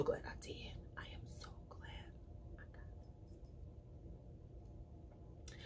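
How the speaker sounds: fast secret whisper to viewers. Real, not acted. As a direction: lean in and whisper it quickly. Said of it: "whispered confidence to viewers".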